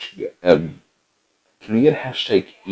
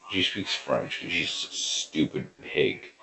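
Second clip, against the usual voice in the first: whispered aside; 10 dB quieter than usual. Acted. Aside after Kermit voice.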